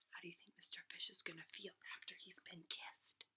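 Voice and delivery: quick secret storybook whisper; hushed whisper. A narrator's reading. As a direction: whisper the question to the kids.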